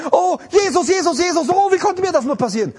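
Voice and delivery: mocking a frightened believer's frantic cry; mock panicked believer. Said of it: "Wir sind immer in Panik".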